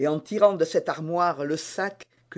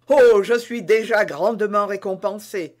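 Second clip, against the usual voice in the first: louder, eager heartfelt outburst; ardent exclamation; 3 semitones higher, 7 dB louder than usual. Acted. D'Artagnan to Constance, ardently declaring himself rewarded. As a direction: blurt it out, overjoyed. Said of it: "s'écria D'Artagnan".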